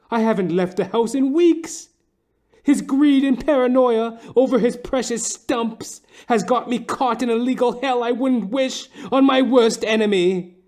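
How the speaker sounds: high, pleading woman's voice. Acted. A frantic wife begs the detective for help, high pleading voice. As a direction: beg him for help, high and tight.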